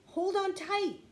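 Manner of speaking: high, loud urgent cry. A narrator's reading. Jack cries out to Annie on the rope, high and loud. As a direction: cry out to her to hold on. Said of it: "cried Jack".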